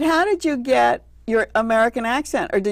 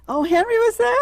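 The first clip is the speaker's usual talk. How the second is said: surprised; 12 semitones higher than usual. Real, not acted. Learns Henry Winkler was there.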